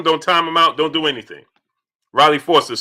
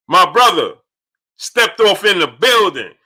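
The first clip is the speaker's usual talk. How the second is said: hype shout-out; 4 semitones higher, 5 dB louder than usual. Real, not acted. Hyping a viewer's arrival.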